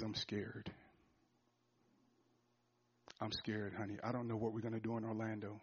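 barely above a breath, humbled; soft confession. Retold or imitated. Confessing fear to his wife.

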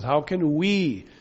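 "we" drawn out slowly with a falling tune; slow question landing hard on "we"; emphasis on we. Shown as a demonstration. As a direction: put great weight on the word "we". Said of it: "Paul puts great emphasis on this word".

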